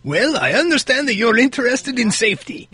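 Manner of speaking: psychic voice. Pretend. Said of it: "my psychic voice is almost exactly the same".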